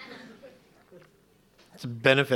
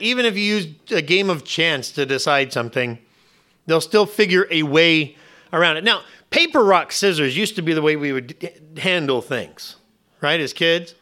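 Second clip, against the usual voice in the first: normal talking voice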